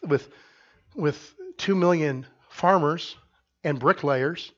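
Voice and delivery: ordinary delivery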